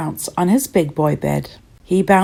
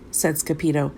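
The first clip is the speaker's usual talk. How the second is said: ordinary delivery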